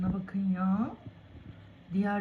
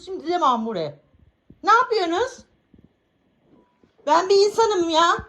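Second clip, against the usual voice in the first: high, loud scolding of the cats; exasperated scolding; 10 semitones higher, 6 dB louder than usual. Real, not acted. Owner scolding her fighting cats, high and loud.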